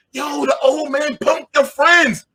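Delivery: high, slow taunting shout; crowd cheering. Retold or imitated. Crowd taunting the young cab driver, high slow shout. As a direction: hype it up like a street crowd.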